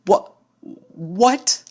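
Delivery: stammering confusion. Acted. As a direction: stammer in total shock. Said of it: "The purple unicorn stammered".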